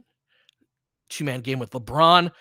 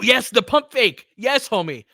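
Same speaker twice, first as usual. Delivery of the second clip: excited cheer; 8 semitones higher, 7 dB louder than usual. Real, not acted. Fan cheering a big play.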